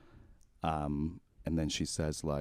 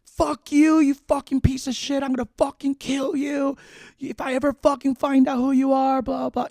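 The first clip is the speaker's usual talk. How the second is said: furious yelling, much higher and louder; terrified rage, screaming down the phone; screaming obscenities; 10 dB louder than usual. Retold or imitated. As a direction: scream threats at the caller out of fear. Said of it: "I started screaming obscenities".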